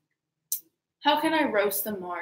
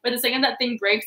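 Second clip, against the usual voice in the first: usual speaking voice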